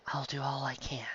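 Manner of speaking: Robin's calm, whispered reply; quiet breathy promise; soft whisper. Acted. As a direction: answer in a hushed, composed whisper. Robin voiced softly.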